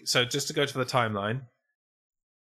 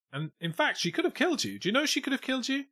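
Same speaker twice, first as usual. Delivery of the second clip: much higher, fast disbelief; imagined retort; 13 semitones higher, faster than usual. Pretend. Imagined boyfriend to sister, high fast disbelief. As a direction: pile on the outrage quickly. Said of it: "At which point the sister went".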